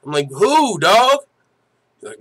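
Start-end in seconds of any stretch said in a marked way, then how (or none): 0.3-1.2 baffled. Retold or imitated. His baffled reply to fighter.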